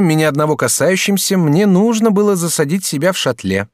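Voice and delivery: put-on young student Jacques. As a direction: play the courteous young student. Student introduces himself.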